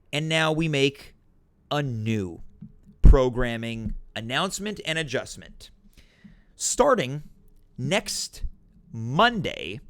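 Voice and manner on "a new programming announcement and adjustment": normal talking voice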